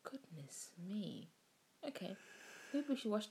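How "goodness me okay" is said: whispering. Real, not acted. Reacting to horror.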